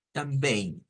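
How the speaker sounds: "também" as tam-bēi, nasal ending, no i-glide before e; Portuguese pronunciation. Shown as a demonstration. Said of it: "Para decir también".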